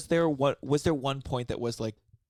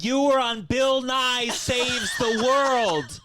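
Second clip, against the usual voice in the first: high, slow condescending doctor; mock snooty doctor; 10 semitones higher, 5 dB louder, much slower than usual. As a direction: drag it out, high and snide. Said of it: "And she's like".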